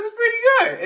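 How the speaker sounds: high-pitched, loud mock amazement; astonished reaction. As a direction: re-enact being stunned, voice shooting up. Acts out shock at accent.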